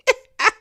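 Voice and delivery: quick high burst of laughter; short high laugh. Real, not acted. Host cracking up at the Kamala joke, short high laugh. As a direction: crack up at your own joke.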